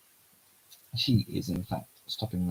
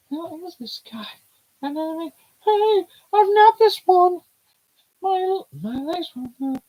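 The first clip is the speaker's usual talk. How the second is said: put-on much higher, girlish and excited; high character voice; 8 dB louder than usual. Voicing a girl who can't move her legs, high and alarmed. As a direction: play the startled girl, bright and high.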